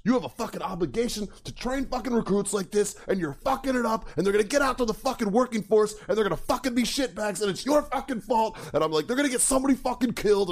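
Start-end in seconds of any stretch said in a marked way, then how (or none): 0.0-8.6 high-pitched, heated tirade without shouting; going off. Retold or imitated. Veteran blaming the instructor for bad training, retold angry rant. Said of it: "I'm fucking going off on him". 9.1-10.5 fast, high-pitched angry retort; going off. Retold or imitated. Veteran warning instructor about recruits, retold fast angry rant. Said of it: "I'm fucking going off on him".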